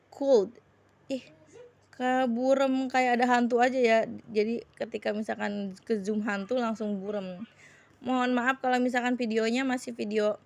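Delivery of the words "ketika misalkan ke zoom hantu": ordinary talk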